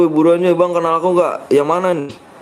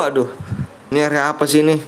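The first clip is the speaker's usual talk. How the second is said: normal talking voice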